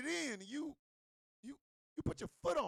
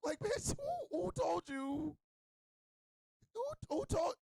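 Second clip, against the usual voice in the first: high, comic indignation; incredulous challenge; 8 semitones higher, slower than usual. Acted. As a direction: demand an answer in a high, affronted voice. Acts out challenging the partner.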